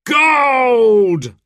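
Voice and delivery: loud high shout of gold; sheriff's cry. A narrator's reading. Sheriff proclaims Robin's gold to the crowd, loud shout. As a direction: bellow the winning result. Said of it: "cried the sheriff".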